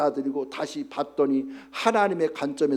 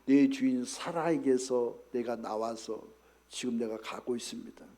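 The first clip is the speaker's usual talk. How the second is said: Hagar's answer; 5 semitones lower, 7 dB quieter than usual. Retold or imitated. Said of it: "하갈이 말하기를".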